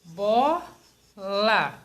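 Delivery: "Bola" split as bo, la with a clear gap; syllable by syllable. Shown as a demonstration. Teacher showing how to separate bola, syllable by syllable. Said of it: "Vamos falar devagar".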